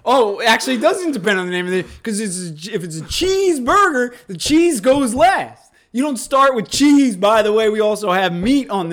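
loud, high, fired-up argument; excited rant. Real, not acted. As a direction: argue it out loud and fast. Continues heated rant.

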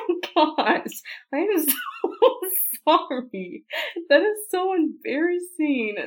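high embarrassed laughter; laughing. Real, not acted. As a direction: giggle through the apology.

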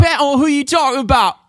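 loud, high British street accent. Shown as a demonstration. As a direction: throw it back in exaggerated British. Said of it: "depends on what accent you're talking about".

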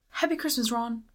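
high mock-British reply; bad British accent. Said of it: "can't do a good British accent".

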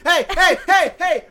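high, loud protest; calling out. Real, not acted. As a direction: call him out across the room. Calling out at rule breaker.

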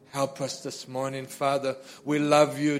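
ordinary talk

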